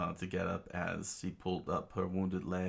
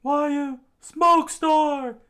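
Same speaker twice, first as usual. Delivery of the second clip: very high, loud falsetto battle cry; high-pitched attack call; battle shout; 13 dB louder, much slower than usual. Acted. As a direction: yell out your attack in falsetto. Usopp shouts as he fires.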